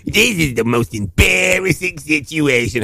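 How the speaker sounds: slow, drawn-out mortified dad; impression of dad. Cohost mimicking friend's dad, mock mortified. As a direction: drag it out like an embarrassed father. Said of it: "sound even more like Harvey Fierstein".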